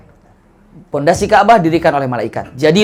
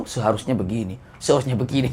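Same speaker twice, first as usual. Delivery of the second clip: low, softer nagging mock complaint; grumbling pilgrim; 5 semitones lower, 7 dB quieter than usual. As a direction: grumble it like a know-it-all pilgrim. (jamaah haji menggerutu pelan, sok tahu)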